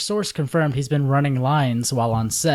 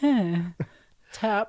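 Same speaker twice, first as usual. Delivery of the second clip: foppish fencer; 8 semitones higher than usual. Retold or imitated. Imitating foppish sword fight.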